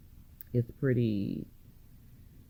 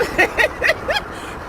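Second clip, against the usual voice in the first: squealing giggle; high delighted laugh. Real, not acted. Vlogger thrilled at the land, high giggle. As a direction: laugh high with pure joy.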